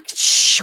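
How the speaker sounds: sharp breathy gasp of mock horror; a hammed-up, breathless intake of shock. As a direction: gasp like the drama just hit. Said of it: "Everything is a shock".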